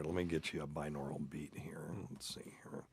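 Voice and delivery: soft whisper to himself; whispered aside. Real, not acted. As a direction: murmur it under your breath. Searching for clip, aside.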